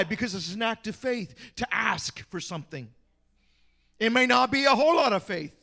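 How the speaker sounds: ordinary talk, nothing marked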